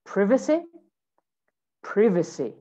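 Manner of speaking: British "privacy", first syllable as short "priv"; British accent. Shown as a demonstration. Teacher models British "privacy" for students, short I. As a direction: say "privacy" with the I of "give". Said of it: "In British English".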